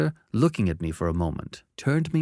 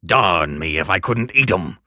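gruff convict; 2 semitones lower, 6 dB louder than usual. Acted. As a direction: growl the order. Threatening convict.

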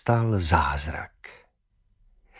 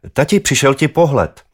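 eager announcement; 7 semitones higher, 6 dB louder than usual. A narrator's reading. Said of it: "oznámil jsem tatínkovi".

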